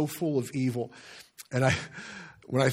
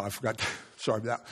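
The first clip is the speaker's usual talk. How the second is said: usual speaking voice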